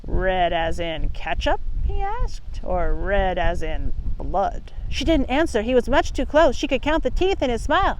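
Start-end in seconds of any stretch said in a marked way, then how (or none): 0.1-4.7 low, slow sly wolf voice; a predator drawing out his words. Acted. Wolf voice questioning Little Red.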